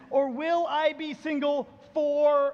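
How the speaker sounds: FOREVER stretched out long and loud; mock lament. Believer asking God about singleness, mock lament. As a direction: drag out "forever" syllable by syllable, swelling.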